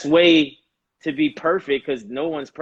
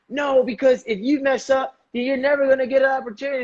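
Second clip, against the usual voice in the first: higher, fast, insistent father voice; hurried scolding father; 10 semitones higher, faster than usual. Retold or imitated. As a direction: rush it like a worried father scolding. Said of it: "I'm the kid and I'm the father".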